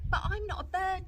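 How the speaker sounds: high, cheeky little sister; Lola's little-girl voice. Acted. Lola voiced by reader.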